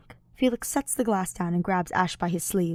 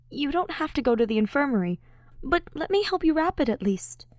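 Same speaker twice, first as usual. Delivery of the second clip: higher, coaxing voice; Ash pleading; 8 semitones higher than usual. Acted. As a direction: gently plead to help.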